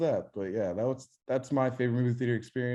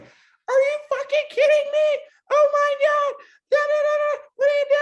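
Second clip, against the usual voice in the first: much higher, louder mock shriek of an outraged young woman; 11 dB louder than usual. Mocking the woman who screamed at his mom, shrill mock shriek. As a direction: screech it like a hysterical stranger, hammed up. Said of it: "she starts screaming at my mom".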